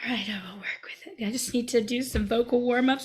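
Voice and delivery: breathy whispered aside; whispering. Real, not acted. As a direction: whisper it under your breath. Quietly whispered aside before scene.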